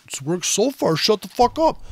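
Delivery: hammed-up annoyed trader telling him off; mock angry retort. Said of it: "they're like".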